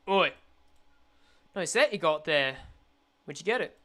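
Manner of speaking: lightly voiced low thug; menacing thug. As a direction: play a street mugger sizing up a mark. Voices a thug accosting someone.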